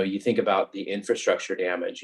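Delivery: ordinary delivery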